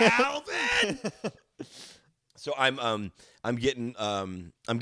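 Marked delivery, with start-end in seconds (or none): 0.0-1.0 high squeaky shout of Alvin; chipmunk voice. Pretend. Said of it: "the chipmunk voices in your head".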